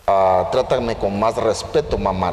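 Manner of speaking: low, self-important put-on voice; haughty donkey. Conceited young donkey to his mother, low haughty tone. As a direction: demand respect in a lofty low register. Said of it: "pero muy enaltecido".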